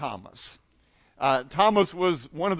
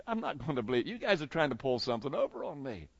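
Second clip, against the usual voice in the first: lower, softer disbelief; dismissive Thomas; 4 semitones lower, 8 dB quieter, faster than usual. Acted. Thomas answering the excited disciples, quietly dismissive. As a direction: brush them off, refusing to be taken in. Voicing Thomas refusing to believe.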